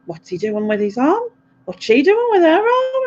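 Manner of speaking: high, puzzled mum voice rising; imitating mum. Mum on the phone, puzzled mock voice. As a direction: mimic mum, pitch climbing on the second question. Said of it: "And she'll be like".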